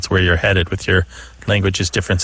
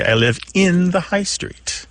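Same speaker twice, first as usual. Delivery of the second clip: slow, higher example; 7 semitones higher than usual. Shown as a demonstration. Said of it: "British English speakers might say".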